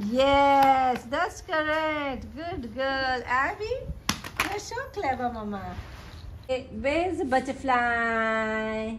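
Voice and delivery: high, sing-song praise. Real, not acted. Mother praising baby for finding butterfly, delighted baby talk.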